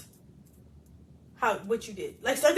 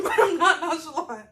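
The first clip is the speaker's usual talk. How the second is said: laughing denial; 6 semitones higher, 6 dB louder than usual. Real, not acted. Denying while laughing.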